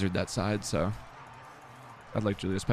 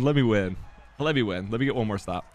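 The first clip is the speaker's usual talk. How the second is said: usual speaking voice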